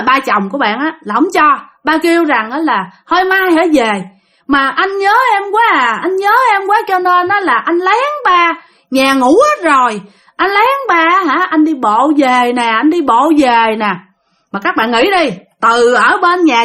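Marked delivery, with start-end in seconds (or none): 3.1-13.9 higher-pitched imitation of a tipsy husband coaxing; drunken pleading. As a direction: wheedle like a lovesick drunk husband. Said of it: "Cái ổng năn nỉ tiếp". (nhại giọng chồng xỉn nài nỉ)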